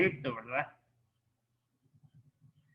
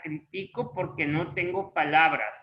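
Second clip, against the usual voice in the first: "critico" as cri-TI-co; stress on the middle syllable. Shown as a demonstration. Teacher showing the form without accent, "critico" stressed on TI. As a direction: say "critico" with the weight on TI. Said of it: "ahí sí no va a llevar".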